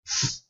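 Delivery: a sustained hissed "s"; demonstrated "s" hiss; voiceless s sound. Shown as a demonstration. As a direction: hold a long unvoiced "s" to show the sound. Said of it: "Olha a diferença".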